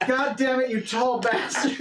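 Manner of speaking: hammed-up high-pitched outrage; high, indignant mock fury; angry wife. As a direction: play a wronged wife telling off a cheat. Angry wife berates tall man.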